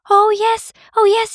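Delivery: very high, loud joyful squeal; excited bird. Acted. Bowerbird seeing its gems again, high excited squeal. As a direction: burst with delight. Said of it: "he was all excited".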